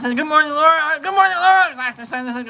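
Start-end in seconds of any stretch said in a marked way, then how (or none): 0.1-1.0 high greeting. Acted. Playful repeated greeting. 1.0-1.7 greeting pitched way up, falling; higher sing-song greeting. Acted. Playful repeated greeting.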